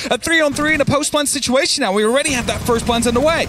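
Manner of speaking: live play-by-play. Real, not acted. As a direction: build the call as tension rises.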